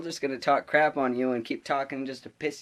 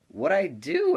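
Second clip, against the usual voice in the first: higher, drawn-out baffled question; acted indignant protest; 7 semitones higher, 4 dB louder, slower than usual. Student to substitute after ejection, indignant. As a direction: plead innocence in disbelief.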